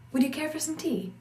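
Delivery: American "Would you care for some tea?"; American accent. Shown as a demonstration. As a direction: offer tea with plain American sounds. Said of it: "In an American pronunciation".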